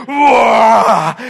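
huge loud roar of a shouter. Retold or imitated. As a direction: blast it out like a bellowing spouse. The shouter explodes in argument.

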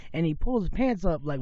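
usual speaking voice

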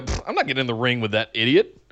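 mock heel sneer. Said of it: "heel it up and go".

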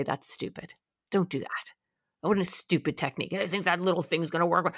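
whispered, then soft scoffing; sneering inner voice. Retold or imitated. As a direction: hiss it like a doubting voice in your ear. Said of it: "Your anxiety will literally say".

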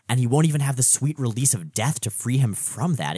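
ordinary talk

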